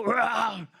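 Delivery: one rough mock roar; lion roar. Preacher imitates the lion heard below, roaring. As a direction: let out a beastly roar. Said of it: "Talagang sumisigaw".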